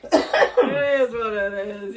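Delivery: loud, very drawn-out mock shrug; jokey resignation. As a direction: stretch it out loudly with a laugh. Joking catchphrase over the story.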